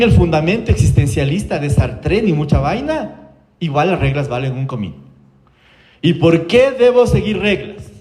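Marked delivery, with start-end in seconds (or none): 6.0-7.9 higher, slow, drawn-out question; child's challenge. Retold or imitated. Un niño de siete años cuestiona en la consulta, desafío imitado. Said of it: "Guagua de siete años en la consulta".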